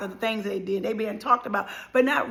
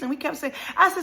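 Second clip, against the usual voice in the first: usual speaking voice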